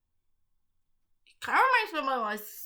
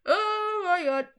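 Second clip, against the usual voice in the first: exasperated; 8 semitones higher, slower than usual. Real, not acted. Exasperated at the hard name.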